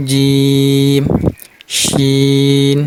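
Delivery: জিম and শিন each stretched out long and even; demonstrated letter names. Shown as a demonstration. Teacher drawing out letter names for Quran students. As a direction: hold every letter name slow and steady. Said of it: "লাগিয়ে উচ্চারণ করতে হবে".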